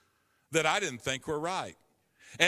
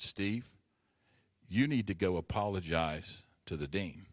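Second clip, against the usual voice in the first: voice of God; 9 semitones lower, 3 dB quieter than usual. Retold or imitated. Holy Spirit speaking to him.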